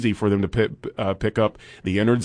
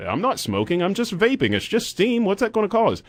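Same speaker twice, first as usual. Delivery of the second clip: high-pitched imitation of an excuse; mock protest; 10 semitones higher than usual. Host mimicking staff caught vaping in the building, mock protest. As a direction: pitch climbing into mimicked indignation.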